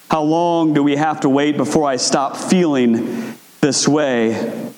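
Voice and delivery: low, earnest plea to God; lament prayer. Acted. Preacher voicing the congregation's prayer, low and pleading.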